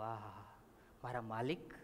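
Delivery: hushed, low gasp of dismay; stunned servant. Acted. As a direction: voice dropped low in quiet shock. Servant's shock at murder plot. (આઘાતમાં ધીમા અવાજે)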